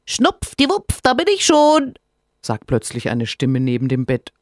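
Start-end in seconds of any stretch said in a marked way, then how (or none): 0.0-1.9 cheeky little creature. Acted. Cold creature Rinus appears.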